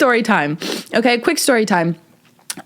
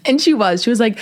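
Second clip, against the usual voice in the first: normal talking voice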